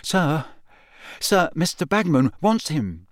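high, hesitant schoolboy plea; nervous. A narrator's reading. Pupil pressing stern teacher, nervous. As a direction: pipe up nervously, pausing after sir. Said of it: "he said nervously".

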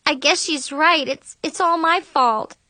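high, upset self-blame; guilty apology. A narrator's reading. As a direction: admit fault in a small, high voice.

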